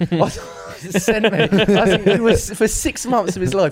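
high, loud, laughing through the words. Real, not acted. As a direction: crack up while trying to keep talking. Cracks up at courgette punchline.

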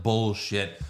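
usual speaking voice

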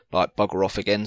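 normal talking voice

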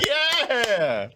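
high, pleased yeah; delighted in the chair; excited. Retold or imitated. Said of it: "I was in my chair like".

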